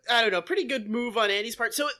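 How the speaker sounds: normal talking voice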